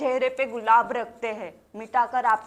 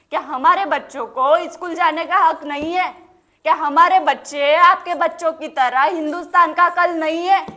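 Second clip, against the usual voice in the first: loud, raised rhetorical questions; impassioned demand; 5 semitones higher, 5 dB louder than usual. Acted. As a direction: challenge the crowd for the children's rights. Demands children's rights from crowd.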